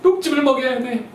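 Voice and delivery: high, laughing mock mom; mock eager mom. Mothers resolving to feed sons green juice, hammed-up high. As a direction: squeal it like a convinced mother. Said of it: "막 어머님들이".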